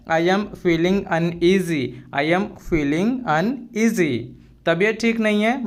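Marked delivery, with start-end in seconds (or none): 0.1-4.2 slow, carefully spelled-out English phrase; slow and clear classroom model phrase; slow teaching demo. Shown as a demonstration. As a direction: say each word slowly for the learner. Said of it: "तो ऐसे बोलेंगे".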